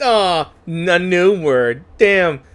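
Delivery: ordinary talk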